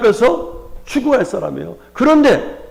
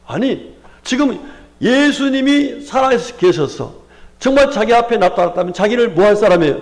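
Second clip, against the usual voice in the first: ordinary delivery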